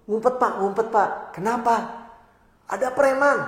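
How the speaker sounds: high, urgent, frightened pleading; a panicked worker warning the boss to hide, urgent and high; panicky employee. Retold or imitated. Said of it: "kenapa kok panik gitu".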